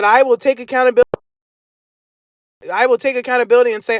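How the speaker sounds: high, fast mocking of her; sarcastic imitation of the other woman; mocking quote. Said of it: "this was her words".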